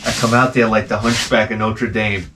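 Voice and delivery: usual speaking voice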